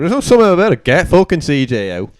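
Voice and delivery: loud, high, indignant; heated outburst. Real, not acted. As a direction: fire it back in disbelief. Heated rebuttal in debate.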